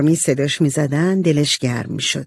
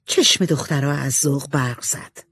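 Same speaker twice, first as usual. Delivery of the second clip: usual speaking voice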